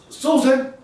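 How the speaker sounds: high, slow call across the house; husband calling out for his wife. Acted. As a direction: call her from the next room. Calling wife from another room.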